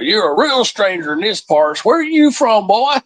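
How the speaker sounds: high mock Texan tycoon; oil tycoon voice. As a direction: play the heavyset oil tycoon, hammed up. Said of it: "big heavy set guy walks up".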